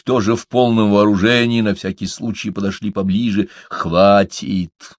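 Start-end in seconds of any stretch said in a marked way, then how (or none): none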